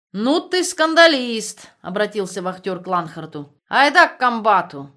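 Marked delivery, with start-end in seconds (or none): 0.1-1.7 loud, slow, higher rebuke; drawn-out loud rebuke. Acted. Guard berates troublemaker. 3.7-4.9 loud barked command; loud order. Acted. Guard orders him out.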